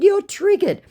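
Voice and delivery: very high, mocking; high, smug "you're triggered"; sing-song taunt. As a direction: needle him with a smug lilt. Taunting the scammer again.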